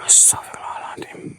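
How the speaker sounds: faint hushed astagfirullahaladzim; whispered prayer. Real, not acted. Ghost hunter seeing the spirit again, whispered prayer. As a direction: barely breathe it out, shaken.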